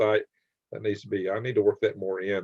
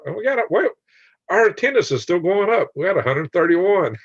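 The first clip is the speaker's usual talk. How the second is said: much higher, louder, delighted surprise; excited announcement; 8 semitones higher, 5 dB louder than usual. Real, not acted. Host telling listeners the attendance is still going up, excited. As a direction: announce the rising count with real excitement.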